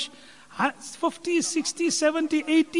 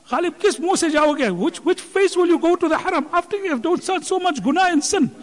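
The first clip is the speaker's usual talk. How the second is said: mocking taunt; 7 semitones higher than usual. People taunting Ghalib, a raised mocking jeer. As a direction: sneer at the sinner going to Hajj. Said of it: "sometimes we taunt people".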